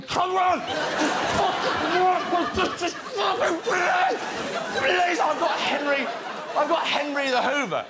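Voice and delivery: mock frantic plea; frantic pleading. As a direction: beg the hoover to work, half laughing. Acting frustration at the hoover.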